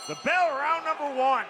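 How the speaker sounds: high, loud call of the bell; excited call. Real, not acted. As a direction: announce the bell with a burst. Fight starts, commentator calls out.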